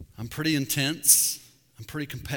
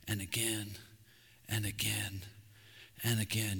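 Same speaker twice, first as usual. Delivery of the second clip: each "and again" softer, the middle whispered; fading repetition; 4 semitones lower, 7 dB quieter than usual. Real, not acted. Pastor on endless repentance, fading to a hush. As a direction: let "and again" fade away.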